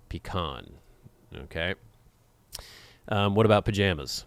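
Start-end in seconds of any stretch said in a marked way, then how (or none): 0.1-0.7 "Pecan" as pee-KAHN; stress on last syllable. Shown as a demonstration. Repeating their own way, pee-KAHN. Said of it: "I emphasize the last syllable".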